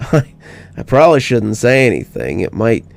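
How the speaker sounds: ordinary delivery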